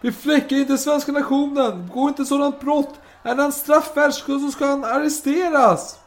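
high, loud, slow declamation; acted officer's shouted protest; impassioned outcry. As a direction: exclaim it as a stirring appeal. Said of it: "Och han utbrister".

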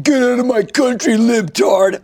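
high, loud mocking shout of an angry heckler. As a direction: yell it like a troll you're parodying.

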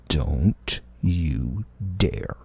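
slow whispered threat; whispered warning. Acted. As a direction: hush it, slow and teasing. Playful warning to Morgan.

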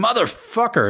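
high pained scream of a curse. A narrator's reading. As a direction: cry out in sudden pain. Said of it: "and he screamed".

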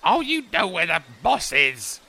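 put-on high, thin voice of a fussy old gnome; a sly old gnome sizing up a tall stranger. Ola mishears moss.

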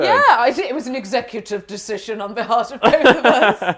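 loud, laughing boast; laughing proudly. Real, not acted. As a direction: claim it grandly through laughter.

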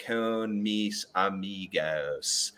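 imitated Long Island student's laboured Spanish; affected Long Island accent. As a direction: drag each Spanish word out in affected Long Island tones.

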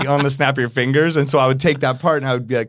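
ordinary talk

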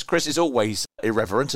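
ordinary talk

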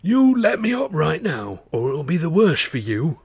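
a strained, bloodied thug's menace; menacing threat. Acted. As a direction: try to sound dangerous through a bloody nose. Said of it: "as menacingly as he could manage".

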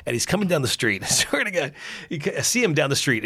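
ordinary talk, nothing marked

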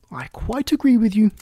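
put-on a chatty, fussy old duchess. Duchess voiced in story.

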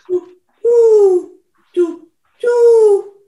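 high, loud hoots; owl hooting. Acted. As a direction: hoot like a startled owl.

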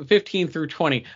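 ordinary delivery

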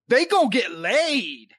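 knowing drawl. Retold or imitated. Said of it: "you're like".

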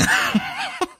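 high, wheezing laughing fit; bursting into laughter. Real, not acted. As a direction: lose it laughing at the slip. Cracks up at the slip.

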